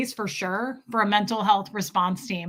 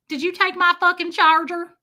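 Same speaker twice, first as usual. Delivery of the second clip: loud, higher Southern twang; Southern accent; 7 semitones higher, 5 dB louder than usual. Shown as a demonstration. Showing the version that doesn't work, loud Southern accent. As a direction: put on a broad Southern accent. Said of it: "I can't imagine otherwise".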